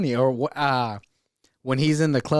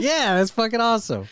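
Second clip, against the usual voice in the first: much higher mock-enthusiastic voice; imitated excitement; 10 semitones higher than usual. Rider just off the roller coaster, imitated excitement. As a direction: gush it in pretend delight. Acts out kid's or Les's reaction.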